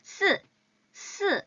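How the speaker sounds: 스 said high and crisp; 스 with lips rounded, a clean s; 스 said as a sharp si; flat si. Shown as a demonstration. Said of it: "이게 사예요".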